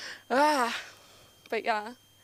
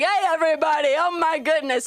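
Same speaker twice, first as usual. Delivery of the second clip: higher, louder hearty greeting; hearty arriving guest; 4 semitones higher, 5 dB louder than usual. Acted. Unknown cousin walking into grandma's kitchen, effusive greeting. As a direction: greet the whole room effusively. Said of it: "Some guy walks in, and he's like".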